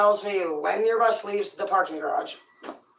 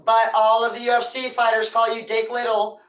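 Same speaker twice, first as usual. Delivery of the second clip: normal talking voice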